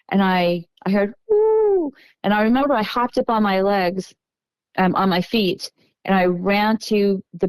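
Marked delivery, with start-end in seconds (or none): 1.3-1.9 high, drawn-out hooting ooh; imitated cooing. Retelling the cooing she heard, high imitated ooh. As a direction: mimic an eerie call from the woods. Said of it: "I heard the cooing from the back of the woods".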